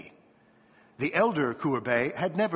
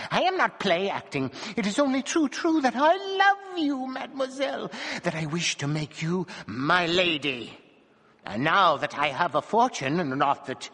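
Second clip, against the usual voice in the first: passionate dwarf; 7 semitones higher than usual. A narrator's reading.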